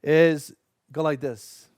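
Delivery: pushed out on held breath; strained, breath held in; holding breath. Real, not acted. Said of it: "I'm holding my breath while I'm doing".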